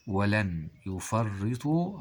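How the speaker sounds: ولن يفرطوا said very slowly with a rise on يفرطوا; slow stressed demo. Shown as a demonstration. Teacher slowly stressing يفرطوا to show the change. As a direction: linger on يفرطوا and lift its end. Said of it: "هذا هو الاختلاف".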